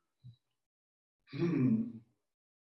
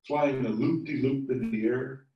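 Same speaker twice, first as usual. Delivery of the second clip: usual speaking voice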